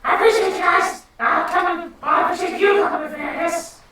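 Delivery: high, loud small-creature voice; loud, high-pitched grudging little character. Acted. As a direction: squeal out grudging thanks way up high. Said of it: "Little G's like".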